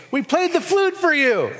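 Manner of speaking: put-on high singsong child call; children calling. Children in the marketplace call to their playmates, high. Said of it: "children sitting in a marketplace calling".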